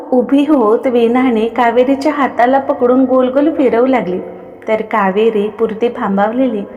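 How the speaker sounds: ordinary delivery, nothing marked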